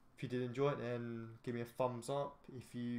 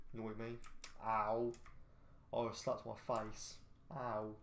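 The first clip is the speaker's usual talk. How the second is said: usual speaking voice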